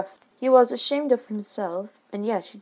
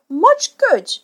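high, loud bitter retort; bitterly; 11 semitones higher, 10 dB louder than usual. A narrator's reading. Father stung by his family, loud bitter remark. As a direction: spit it out, hurt and resentful. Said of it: "he said bitterly".

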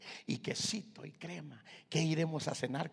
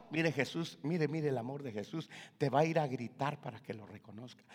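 usual speaking voice

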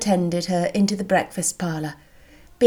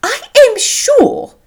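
very high, loud, slow gushing matron; profuse mother; 8 dB louder, slower than usual. A narrator's reading. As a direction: gush thanks as an effusive mother. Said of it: "was profuse in her acknowledgments".

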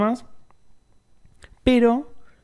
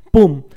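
gavel bang; 8 dB louder than usual. Retold or imitated. Gavel strikes at auction.

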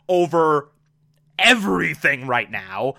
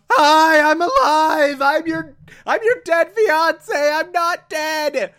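high, louder imagined outburst; yelling out; 10 semitones higher, 4 dB louder than usual. Retold or imitated. Finch longing to shout to his fiancée, high loud yell. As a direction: burst out like a man bursting to be recognized. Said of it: "wants to yell out".

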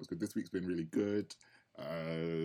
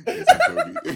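squeaky, much higher laughing mock introduction; mock Toby; 19 dB louder than usual. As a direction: play Toby sneaking into the men's group. Mocking Toby crashing men's chat.

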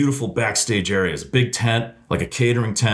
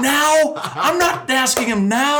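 high, loud, hammed-up panic; panicked refusal; 3 dB louder, slower than usual. Asked to step up after the blowup, a high, loud hammed-up panicked refusal. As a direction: squeal in terror at the thought of going next. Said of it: "I'm like".

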